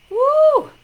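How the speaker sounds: high, loud cheering "woo"; excited whoop. Real, not acted. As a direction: let out a big whoop. Cheers as viewers arrive.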